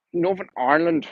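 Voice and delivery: "Northern Ireland" said in a light, tentative Northern Irish accent; attempted Northern Irish accent. Shown as a demonstration. To his stream audience, a hesitant attempt at the Northern Irish accent. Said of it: "I can't do the accent".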